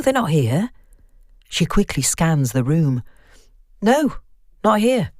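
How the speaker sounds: nervous, rising worry on the phone; a hint of a frightened friend; lightly voiced Vicky.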